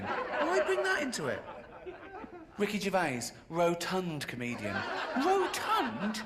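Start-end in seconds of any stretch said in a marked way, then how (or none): 0.0-1.4 high, slow indignant question; high wounded protest; mock outrage. Real, not acted. Outraged at tabloid adjective. 5.2-6.2 high, slow huffy denial; mock indignation. Real, not acted. Outraged at being called rotund.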